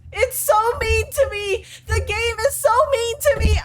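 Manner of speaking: high, teary fake sob; mock crying whine. Streamer whining that the game is unfair, teary. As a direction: whine like a wronged child.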